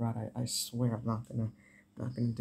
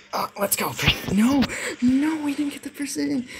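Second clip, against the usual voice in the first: yelling at the game in frustration; sharp rising yell of disbelief; angry outburst; 13 semitones higher, 7 dB louder than usual. Real, not acted. Frustrated gamer losing a fight.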